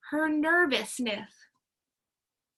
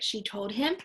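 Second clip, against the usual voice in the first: ordinary delivery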